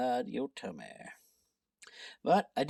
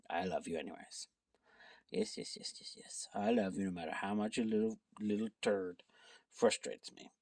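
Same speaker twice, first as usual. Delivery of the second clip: tender pet talk; 7 semitones lower than usual. Real, not acted. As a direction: whisper sweet nothings to the cat. Talking tenderly to her cat.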